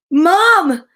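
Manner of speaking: high, loud frightened calls; screaming for Mom. Acted. Scared Jasper screaming for his mom, high and loud. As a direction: yell for Mom in panic. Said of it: "Jasper screamed".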